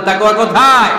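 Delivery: rally the crowd loudly; loud call-out. Shown as a demonstration. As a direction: shout the question to the crowd. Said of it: "চিল্লায় বলতে হবে মুসলমান". (জোরে চিৎকার করে প্রশ্ন)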